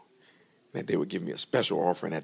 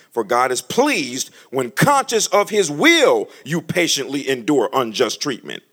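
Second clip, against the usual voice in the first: scripture reading; 4 semitones higher, 7 dB louder, slower than usual. Acted. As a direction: read out with weight, louder. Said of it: "First Peter two nineteen".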